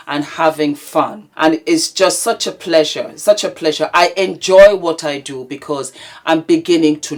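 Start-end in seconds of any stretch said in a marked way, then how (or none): none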